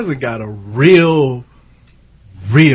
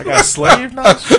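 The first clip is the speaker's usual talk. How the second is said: laughing; 11 semitones higher, 6 dB louder, slower than usual. Real, not acted. Laughing mock outrage.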